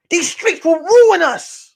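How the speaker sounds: high, slow, drawn-out plea; melodramatic warning. Retold or imitated. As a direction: warn her with hammed-up alarm.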